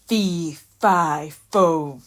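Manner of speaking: loud booming giant's chant; giant bellowing. Acted. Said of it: "the giant entered bellowing".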